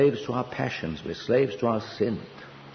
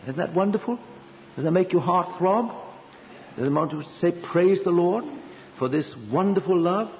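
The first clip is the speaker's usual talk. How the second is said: higher, eager questions to the church; rousing appeal; 8 semitones higher than usual. Real, not acted. Preacher urging listeners to praise, lifted higher. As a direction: stir them to praise.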